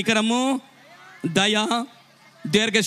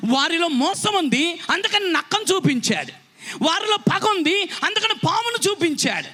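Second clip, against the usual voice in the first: high, driving sermon delivery; full-force preaching; 7 semitones higher than usual. Real, not acted. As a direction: preach it hard, pitch raised, ringing out each point. (గొంతు పెంచి ఆవేశంగా ప్రసంగం)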